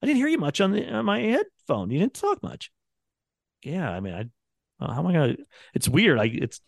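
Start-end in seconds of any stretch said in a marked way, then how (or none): 0.0-2.7 higher mock complaint from the boss; boss complaining. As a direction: imitate the boss asking where you were. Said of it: "Not yell at me, but like".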